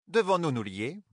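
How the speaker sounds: voix bien plus aiguë, vive; propose-le nerveusement; sœur grise. A narrator's reading. (la sœur grise propose un cercle à Katsuane)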